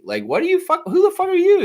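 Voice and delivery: high, loud incredulous yell; furious who-are-you shout; indignant outburst. Real, not acted. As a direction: snap at the critics in disbelief. Angry rant at critics.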